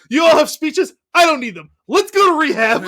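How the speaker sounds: high, emphatic mock enthusiasm; eager excitement. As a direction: cut in eagerly, hammed up and high. Acted eager brush-off at the intervention.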